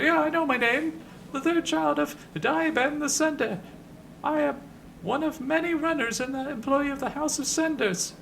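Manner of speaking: very high falsetto, formal introduction; Ben the Sender. Acted. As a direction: introduce yourself grandly in a piping falsetto. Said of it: "in a high voice".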